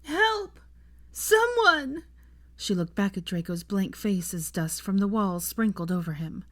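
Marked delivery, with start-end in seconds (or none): 0.0-2.0 high, desperate calls; panicked, building shouts; screaming for help. A narrator's reading. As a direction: cry out far up for anyone. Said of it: "The sound echoed intensely".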